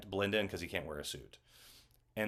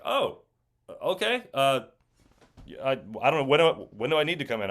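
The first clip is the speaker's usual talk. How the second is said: caught off guard; 4 semitones higher, 5 dB louder than usual. Retold or imitated. Retelling surprise call about role.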